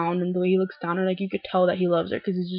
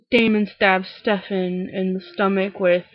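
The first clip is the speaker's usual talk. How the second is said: normal talking voice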